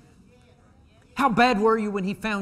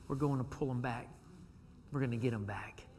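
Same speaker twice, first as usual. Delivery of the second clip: whisper; 5 semitones lower, 11 dB quieter than usual. Acted. Whispered resolve.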